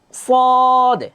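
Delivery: সদ held long and high, a full sustained vowel; correct recitation. Shown as a demonstration. Teacher modeling the right sound for students. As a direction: sing out সদ slowly like Quran recitation. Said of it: "এভাবে খেয়াল করেন".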